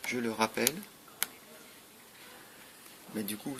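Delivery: ordinary talk, nothing marked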